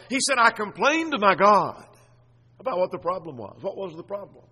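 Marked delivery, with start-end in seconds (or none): 0.4-1.9 loud, slow, raised complaint; crying out. Acted. Psalmist crying out to God in his trouble, crying out. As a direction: cry it out slowly to God. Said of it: "He remembered to cry out to God".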